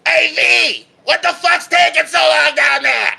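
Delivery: high-pitched yell from far off; impatient shout. Acted. Companion calling down to the rescuer, impatient shouting. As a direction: holler down impatiently. Another character calls down impatiently.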